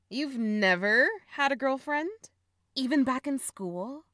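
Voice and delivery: can't believe what you just heard; astonished, voice jumping up; shocked disbelief. Acted. Sister shocked at brother's confession.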